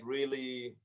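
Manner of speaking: ordinary delivery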